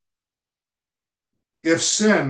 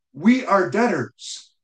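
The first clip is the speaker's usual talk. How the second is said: "We are debtors" declared boldly, pitched higher; bold statement; 5 semitones higher than usual. Shown as a demonstration. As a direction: announce "We are debtors" as a bold opening claim. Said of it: "He starts with that bald, bold statement".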